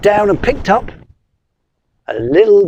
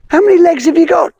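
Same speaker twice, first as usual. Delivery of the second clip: quick question; 10 semitones higher, much faster than usual. Acted. Wizard fast question.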